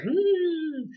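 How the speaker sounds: high, squeaky pondering hmm; mock suspicious hmm. Chespin voiced facing rival.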